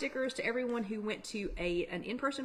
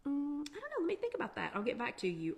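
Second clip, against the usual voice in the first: hesitant stalling; 6 semitones higher, faster than usual. Acted. Putting off an immediate answer to someone, hesitant stalling. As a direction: stall for time, pitch up then settling down.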